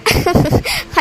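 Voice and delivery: breathy, mocking chuckle; dismissive laugh. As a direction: laugh off her mother's worry. Daughter laughs at mother's worry.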